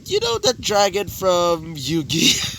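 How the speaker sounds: ordinary delivery, nothing marked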